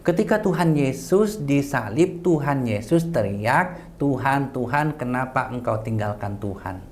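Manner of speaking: slow, spaced-out phrases; deliberate, drawn-out retelling; slow explanatory recital. Shown as a demonstration. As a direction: make it easy to follow, word by word. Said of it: "Biar mudah dipahami, saya terjemahkan semua".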